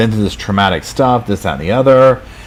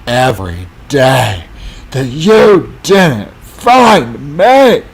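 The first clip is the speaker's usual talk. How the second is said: high, slow, put-on whimper of hurt; mock upset wife; 6 semitones higher, 5 dB louder, much slower than usual. Wife guilt-tripping her husband, whiny mock sobbing. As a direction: whine it out, milking the pity, much higher and louder. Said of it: "she gets all upset".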